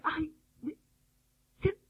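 high, lightly startled onlooker; mock alarm. Preacher mimics newcomers seeing people fall, mock fright.

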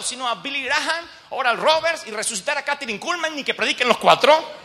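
high-pitched, mounting list of grand efforts; emphatic hypothetical. Acted. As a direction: build each offer like a rally preacher. Servant lists grand useless campaign efforts. (enumeración exaltada en tono agudo)

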